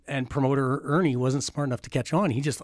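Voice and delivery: ordinary delivery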